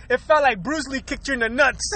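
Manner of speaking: ordinary delivery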